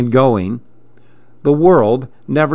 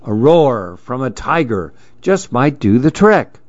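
ordinary delivery, nothing marked

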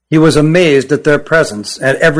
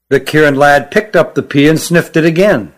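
ordinary delivery

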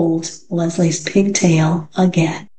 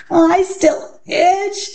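high, slow, drawn-out whine; itchy schoolgirl's long whiny grumble; complaining; 11 semitones higher, much slower than usual. A narrator's reading. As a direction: stretch out the complaint in a high child's voice. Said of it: "Dana complained".

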